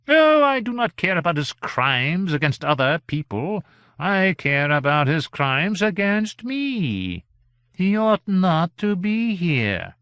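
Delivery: high, imperious gentleman, slow and indignant; haughty character voice, aggrieved and high. A narrator's reading. Villainous gentleman protests.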